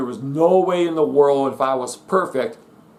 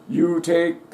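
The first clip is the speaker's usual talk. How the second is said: ordinary talk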